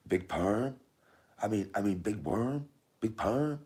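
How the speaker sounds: high questioning put-on voice; playful high-pitched film quote; high film-line impression. Repeats film line in character.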